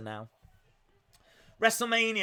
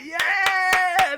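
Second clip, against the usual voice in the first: mock fan cheer; 12 semitones higher, 5 dB louder than usual. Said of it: "he's like".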